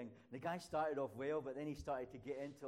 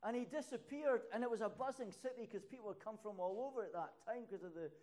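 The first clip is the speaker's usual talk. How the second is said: preaching at full force; 11 semitones higher, 10 dB louder than usual. Acted. Preacher to congregation, crying out they lost Jesus, full-force preaching. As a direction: build it up to a thundering sermon peak.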